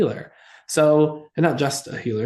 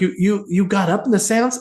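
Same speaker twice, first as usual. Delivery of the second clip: higher, stuttering mock alarm; stammering disbelief; 6 semitones higher than usual. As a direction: trip over the words in shock.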